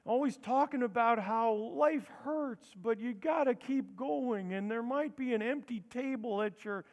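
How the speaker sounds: folksy grandpa consoling you, taking his time; Biden impression. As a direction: amble through it like an old-timey stoner grandpa. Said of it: "an old-timey grandpa and Owen Wilson".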